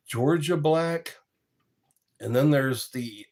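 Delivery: normal talking voice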